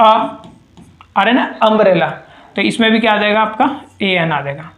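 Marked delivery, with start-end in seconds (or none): none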